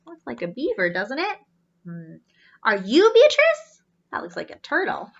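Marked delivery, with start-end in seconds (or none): 2.6-3.8 put-on eager young robot. Z asks again.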